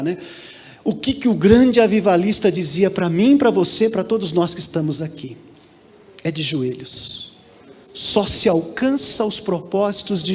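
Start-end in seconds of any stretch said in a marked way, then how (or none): 0.8-5.4 lowered voice, easing down to a soft close; without shouting. Real, not acted. Pastor with a microphone re-asking the church, no shouting. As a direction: ask it lower, letting it settle. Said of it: "não precisa nem gritar".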